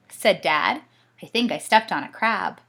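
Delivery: lively storybook reading with voices; reading to children. A narrator's reading. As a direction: read it like a bedtime story.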